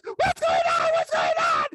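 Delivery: shrieked, frantic mother; mock panicked shouting. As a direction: scream it out in hammed-up panic. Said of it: "And she's panicking".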